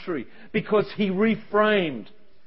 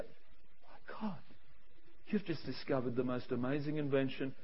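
very soft, low mock awe; hushed amazement; 7 semitones lower, 16 dB quieter than usual. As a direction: breathe it in quiet, acted astonishment. Acted awe at friend's idea.

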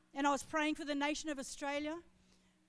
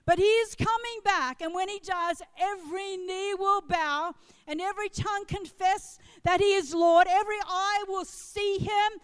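loud, raised-pitch proclamation, easing on the last word; impassioned sermon climax at high volume; full-force preaching; 4 semitones higher, 10 dB louder than usual. Real, not acted.

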